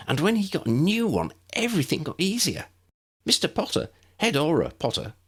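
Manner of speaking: put-on eager young woman. A narrator's reading. Lydia explains eagerly.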